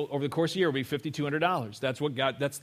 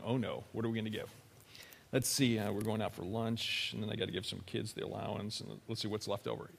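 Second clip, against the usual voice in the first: muttering to self; 7 semitones lower, 12 dB quieter than usual. Retold or imitated. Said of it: "reaching in your pocket going".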